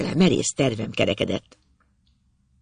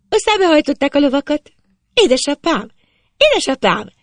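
put-on very high, loud girl's call; shouting full-throated; 11 semitones higher, 5 dB louder than usual. A narrator's reading. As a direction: yell for father at the top of your voice. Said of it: "Kezdett torka szakadtába kiabálni".

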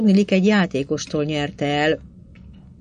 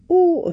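a high, wondering oh full of craving; a narrated sigh, higher and hungry; longing, eager oh; 13 semitones higher than usual. A narrator's reading. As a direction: let the oh ache with greed and wonder. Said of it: "vágyakozóan, elcsodálkozva és mohón".

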